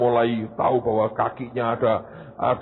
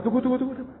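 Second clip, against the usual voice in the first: much higher, rapid stop-stop; urgent wait; 12 semitones higher, much faster than usual. Acted. Manager stopping the boy, high and urgent. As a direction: cut him off eagerly.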